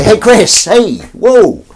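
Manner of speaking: loud, high attention-getting shout; big mock-eager call; pretend calling out. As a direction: hail someone across a room, playfully. Calling out to get Chris's attention.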